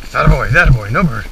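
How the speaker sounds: loud, hearty praise for the dog; eager praise. Real, not acted. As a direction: cheer the dog on loudly. Praising dog after gun shot.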